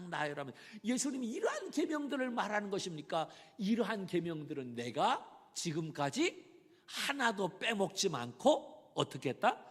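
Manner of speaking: usual speaking voice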